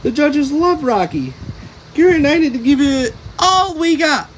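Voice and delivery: alarm bells ringing; panicked. A narrator's reading. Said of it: "SpongeBob started to panic".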